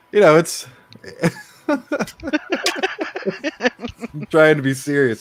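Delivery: fighting back laughter; giggling while trying to stay serious; stifled giggles. Real, not acted. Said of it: "I'm trying not to laugh".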